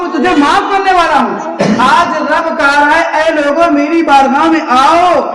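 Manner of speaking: high, sustained oratorical call; impassioned preaching. Real, not acted. Preacher voicing God's call to come and reconcile, impassioned preaching. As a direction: ring out each appeal like a summons.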